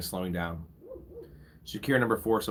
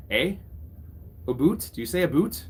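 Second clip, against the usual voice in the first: put-on teasing "a boot" in a jokey Canadian accent, a bit higher; mock Canadian accent; 4 semitones higher than usual. Streamer teasing a Canadian chatter, mock Canadian accent. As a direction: poke fun at the Canadian "about" as "a boot".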